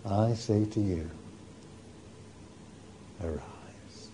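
low, very soft, fading to a whisper on arise; Jesus softly waking the girl; hushed tender. Acted. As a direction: coax her awake gently. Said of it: "he said".

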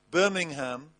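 usual speaking voice

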